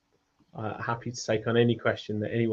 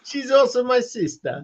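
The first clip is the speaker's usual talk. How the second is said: higher hammed-up Borat boasting; mock Borat; 12 semitones higher than usual. Riffing the Borat bit for the friends. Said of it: "sounding like Borat".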